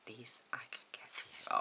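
rereads the note in a breathy whisper; whispered reading. Shown as a demonstration. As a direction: whisper it to yourself as you read. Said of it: "oh, what's that say?".

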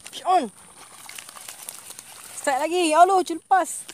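high loud calls, then the loss; excited call. Real, not acted. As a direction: shout the strike, then dismay. Strike then fish escapes. (jeritan teruja)

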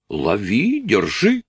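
lightly acted cry to seize the runaway; mild mock shouting. A narrator's reading. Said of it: "закричали паны, диким хором".

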